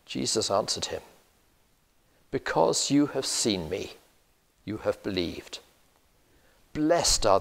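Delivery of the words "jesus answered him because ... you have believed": ordinary talk, nothing marked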